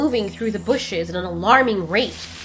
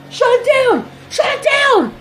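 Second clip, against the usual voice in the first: high, loud panicked scream; frantic digger screaming to shut it down; 7 dB louder than usual. Acted. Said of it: "One of the diggers scream out".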